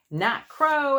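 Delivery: put-on a kid shouting at each new bird; excited shout. A narrator's reading. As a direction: call it out with delight. Child spotting birds, shouting.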